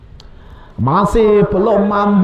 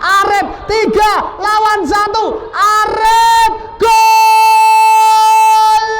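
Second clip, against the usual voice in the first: high, loud goal yell; goal shout; 9 semitones higher, 4 dB louder, much slower than usual. Real, not acted. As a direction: explode into the goal call. Goal scored in live commentary.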